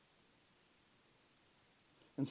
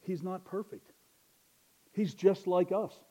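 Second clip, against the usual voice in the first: normal talking voice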